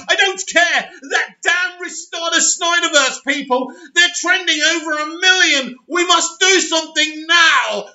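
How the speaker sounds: high-pitched shouted orders; hammed-up studio boss yelling orders; panicked boss shouting. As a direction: bark commands at him in a panic. Boss yelling over the Snyderverse trend.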